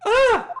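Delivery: sharp high scream of alarm; high panicked shriek. Acted. Character panics at bomb.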